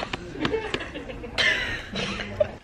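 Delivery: high-pitched burst of giggling; high giggle. Real, not acted. Laughing at besties line.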